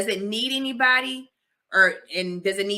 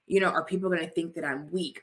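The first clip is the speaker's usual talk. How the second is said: ordinary talk, nothing marked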